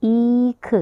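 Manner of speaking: ईख with a long drawn ई; long-i example for class. Shown as a demonstration. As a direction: stretch the ई in ईख. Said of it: "इसका थोड़ा हम लंबा उच्चारण करते हैं".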